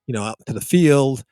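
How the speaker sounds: normal talking voice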